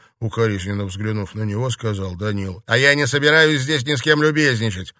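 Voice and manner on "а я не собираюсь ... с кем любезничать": loud, high-pitched angry retort; heated, insistent protest; shouting angrily. A narrator's reading. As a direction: raise your voice in irritation. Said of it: "«Хватит кричать»".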